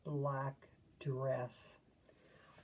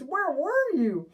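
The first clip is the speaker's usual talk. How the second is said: put-on eager kids; 10 semitones higher, 7 dB louder than usual.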